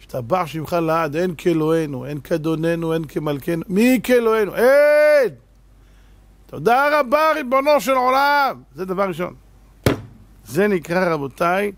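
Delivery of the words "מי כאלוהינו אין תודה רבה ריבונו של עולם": much higher, louder fervent cry; preaching at full force. Acted. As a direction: cry out praise from the pulpit. Preaching crescendo. (דרשן צועק בהתלהבות)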